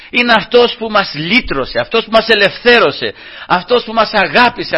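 high, ringing sermon voice; fervent preaching. Acted. Pastor urging brothers, fervent and ringing. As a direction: preach it out with rising fervour.

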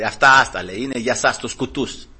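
low, understated mock scorn; ironic dismissal. Proud listeners scoffing at believers, ironic. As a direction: brush it off with quiet irony. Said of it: "ηρωνικά".

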